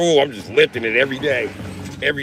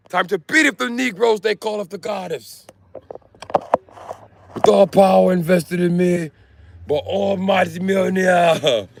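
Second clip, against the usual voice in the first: high, slow booming declaration; mock Thor; 8 semitones higher than usual. Mechanic playing Thor with a war hammer, high proclamation. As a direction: declare it like a god of thunder.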